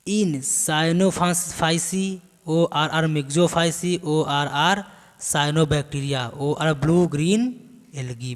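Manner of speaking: measured classroom note-reading; dictation. Acted. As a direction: dictate the terms one by one, slowly.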